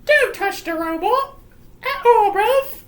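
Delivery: high, loud put-on voice; squeaky, hammed-up telling-off; father's warning. Father forbidding his daughter, in a high cartoonish voice. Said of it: "And he says".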